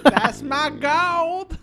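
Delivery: put-on drawn-out sassy Southern accent; sassy Southern leprechaun. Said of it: "sassy Southern".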